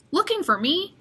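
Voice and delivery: a hint of a cheerful chief; police chief. Chief greeting Ravi at the desk, friendly question. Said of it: "she asks".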